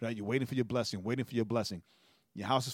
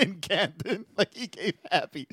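laughing through the words, slowed and higher; amused laughter; 6 semitones higher than usual. Real, not acted. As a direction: chuckle it out in disbelief. Laughing at the mansion.

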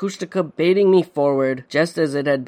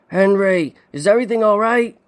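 high, loud call across the ice; 6 semitones higher, 4 dB louder than usual. A narrator's reading. Son calling to his trapped father, worried shout.

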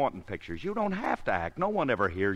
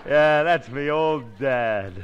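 slow, louder playful papa greeting; pretend dad; 4 dB louder, slower than usual. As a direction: play proud new father to the mirror. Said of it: "look at me in that mirror".

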